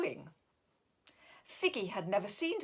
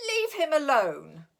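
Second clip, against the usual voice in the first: pleading shout; 6 semitones higher, 8 dB louder than usual. Acted. Defending snow bear.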